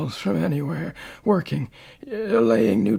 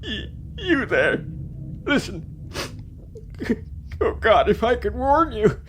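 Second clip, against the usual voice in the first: anguished cry; 6 semitones higher than usual. Acted. Old man pleads with past self.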